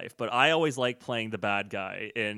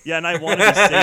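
excited; 5 semitones higher, 12 dB louder, faster than usual. Acted. Joking loud villain claim.

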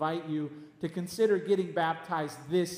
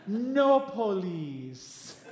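very slow, gentle imitation; Pastor Timothy; 3 dB louder, much slower than usual. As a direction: stretch each word out, gently. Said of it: "That's how he talks".

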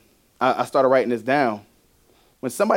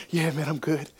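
subdued reassurance; 7 dB quieter than usual. Retold or imitated. Pretending to Carl he is fine.

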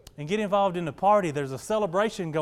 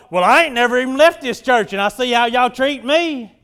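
loud, aggrieved mock complaint; jealous and mad; 5 semitones higher, 10 dB louder than usual. Church member complaining from the corner, mock jealous anger. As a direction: imitate a resentful churchgoer, loudly and higher. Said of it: "jealous and envious and mad and upset".

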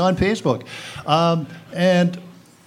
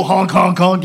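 loud goofy honk noises; 5 semitones higher, 8 dB louder than usual. Retold or imitated. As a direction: honk like a clown horn. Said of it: "Mad Mel".

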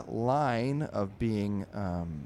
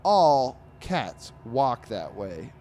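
higher, louder, slow put-on dog voice; grumpy cartoon dog; 4 semitones higher, 8 dB louder, slower than usual. Dog grumbles to owner about cats, slow hammed-up cranky voice. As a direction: drag out the complaint like a cranky pet.

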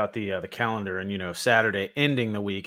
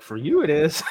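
laughing retort; 6 semitones higher, 4 dB louder than usual. Retold or imitated. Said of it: "I'm like".

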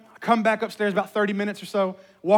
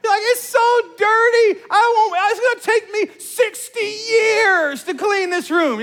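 high, loud, drawn-out mock wail; child throwing a fit; 14 semitones higher, 8 dB louder, slower than usual. Dad acting out his daughter facing her messy room, wailing mock tantrum. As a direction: imitate an overwhelmed little kid's tantrum. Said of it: "she, like, threw a fit".

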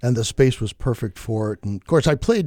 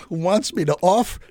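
normal talking voice

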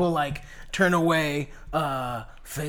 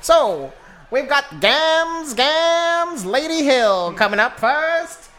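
vaudeville announcer; 5 semitones higher than usual. Acted.

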